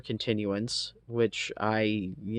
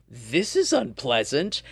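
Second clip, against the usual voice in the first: mock understatement; 3 semitones higher, 4 dB louder than usual. Quotes own mild reaction mid-rant.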